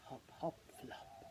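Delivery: soft low whispered hop hop flop. Shown as a demonstration. Reading to a child, whispering the rabbits' hops. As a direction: breathe it so the rabbits sleep. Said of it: "but much quieter".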